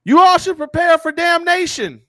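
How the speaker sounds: high, loud mock hellfire warning; street preacher. Preacher warning drivers stuck at red lights, loud high proclamation. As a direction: holler doom as if through a microphone. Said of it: "Listen to what they're telling you".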